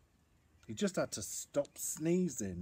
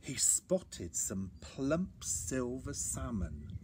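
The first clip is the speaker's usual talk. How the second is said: ordinary delivery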